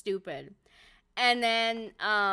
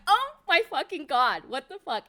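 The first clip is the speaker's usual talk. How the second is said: louder, higher starstruck outburst; gushing at meeting her hero; excited disbelief; 5 semitones higher, 6 dB louder than usual. Retold or imitated. Fan meeting Usopp's face character, excited outburst. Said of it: "And I was like".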